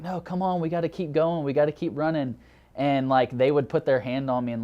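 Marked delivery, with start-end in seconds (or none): 0.0-2.4 higher, eager mock kid voice pushing him to keep running; playful imitation of kids egging on a tired runner; kids urging him on. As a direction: cheer him along like an excited little kid. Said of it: "They're like".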